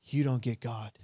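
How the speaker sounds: normal talking voice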